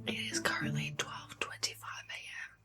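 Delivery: hushed late-night whisper; real whisper, confiding to the camera. Real, not acted. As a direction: whisper so the sleeping household stays asleep. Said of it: "I am whispering because people in my house are sleeping".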